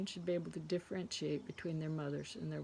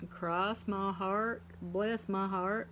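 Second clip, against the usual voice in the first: slow, stretched Southern accent, every heart drawn out; slow Southern drawl; slower than usual. Shown as a demonstration. Joking about sounding like a sweet Southern gal, slow Southern accent. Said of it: "I'm not trying to sound Southern".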